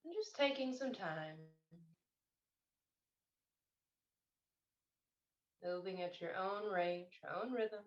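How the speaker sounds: slow, soft meditation voice with long pauses; guided meditation. Real, not acted. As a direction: gently guide them into stillness, unhurried, phrase by phrase. Leads a meditation.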